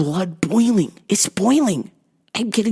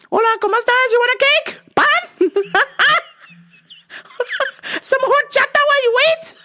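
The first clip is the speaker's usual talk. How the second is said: much higher sweet shopkeeper voice; bakery lady voice. Retold or imitated. Bakery lady greeting the dad, high cheerful voice.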